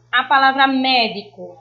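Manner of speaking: ordinary delivery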